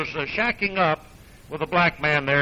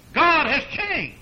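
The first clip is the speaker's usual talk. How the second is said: slow, high mock pronouncement; sarcastic, drawn-out and high; 9 semitones higher, slower than usual. Shown as a demonstration. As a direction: drip sarcasm over it. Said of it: "Now, I'm being sarcastic".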